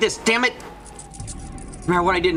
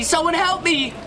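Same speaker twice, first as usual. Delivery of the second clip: even higher, desperate cry for help; panicked scream; slower than usual. Acted. Trapped behind the door, screaming to anyone outside in panic. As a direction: scream it, nearly breaking.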